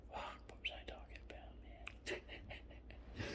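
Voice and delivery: a real whisper to yourself, soft and trailing; lost-thread whisper. Real, not acted. To himself after losing the story, a bewildered whisper. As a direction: mutter it under your breath, real confusion. Loses his train of thought.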